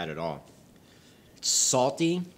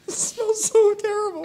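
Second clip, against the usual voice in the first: high, slow wheezing laugh-talk; words squeezed out through laughter; laughing hard; 5 dB louder, slower than usual. Real, not acted. Laughing fit over smelly beer.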